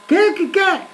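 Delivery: high, straining "what, what, what?"; can't hear you. Acted. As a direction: cup your ear and call back over the wind. Acting not hearing over wind.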